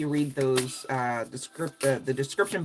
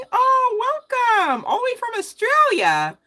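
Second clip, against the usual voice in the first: excited welcome; 7 dB louder than usual. Real, not acted. Greeting a viewer from Australia.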